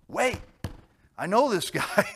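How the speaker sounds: high, loud excited cry; a flash of relief; sudden realization. Retold or imitated. Drowning believer suddenly remembering Jesus, high and loud.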